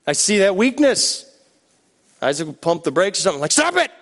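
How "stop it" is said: high sharp yelp; mock alarmed cry. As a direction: brother panics at the wheel, high cry. Brother hits brakes, cries out.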